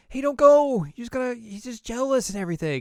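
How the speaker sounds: high-pitched pleading character voice; mock pleading. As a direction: call after her in a high, urgent plea. Child character pleads after friend.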